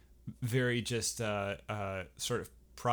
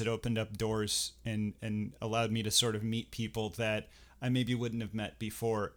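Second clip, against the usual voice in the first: ordinary delivery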